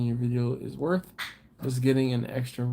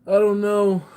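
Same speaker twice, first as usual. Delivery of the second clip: laughing outburst; 8 semitones higher, 8 dB louder than usual. Real, not acted. Laughing loud denial.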